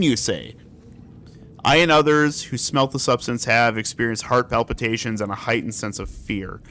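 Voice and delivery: low, even document read; reading aloud. Acted. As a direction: read the report out calmly. Reads in-game document aloud.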